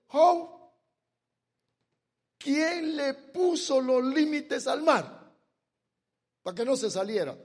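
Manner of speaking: God challenges Job; God questioning Job. Acted.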